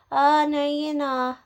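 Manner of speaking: loud, high singsong taunt; mocking taunt. Mimicking neighbour's taunt.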